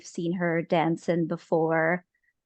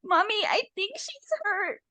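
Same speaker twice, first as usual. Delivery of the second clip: put-on very high, tearful child voice; upset child's high-pitched wail, acted; crying little girl; 12 semitones higher than usual. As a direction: plead to Daddy through tears. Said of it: "Ashley is still crying".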